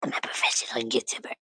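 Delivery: low, hushed whisper. Pretend. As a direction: whisper it under your breath. Same line whispered, then voiced.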